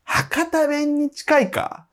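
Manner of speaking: high, slow, drawn-out question; incredulous challenge. Real, not acted. Challenging co-host's dialect claim, incredulous drawl.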